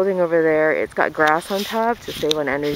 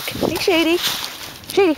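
high call to dog; 11 semitones higher than usual. Real, not acted. Calling her dog.

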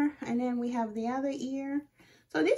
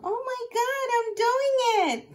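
excited; 11 semitones higher, 7 dB louder than usual. Real, not acted. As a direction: savour the thrill of the first cut. Excited first cut on camera.

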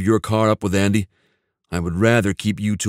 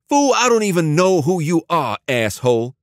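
higher, gang-boss voice spitting insults; a thug lashing out at an unknown caller; angry gang boss; 9 semitones higher, 3 dB louder than usual. A narrator's reading. Dante insulting the stranger on speakerphone, heated.

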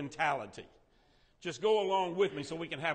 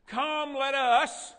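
voice of God; 7 semitones higher, 6 dB louder, much slower than usual. Acted. Reads God's words in Genesis.

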